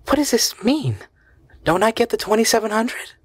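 high, breathy dismay; dismayed shock. Acted. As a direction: she can't believe what she reads. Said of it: "a look of consternation".

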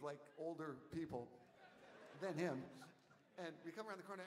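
normal talking voice

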